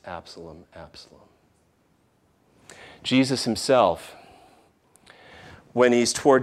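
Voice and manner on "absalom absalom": softly grieving. Retold or imitated. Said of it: "that phrase".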